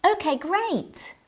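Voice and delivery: "Okay, great" glad about the tea; pleased okay. Shown as a demonstration. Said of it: "Intonation matters. Listen to this".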